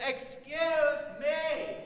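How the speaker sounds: stretched-out squeak calling up to an elephant; tiny mouse voice. A narrator's reading. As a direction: sing it up high like a tiny mouse. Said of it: "He said".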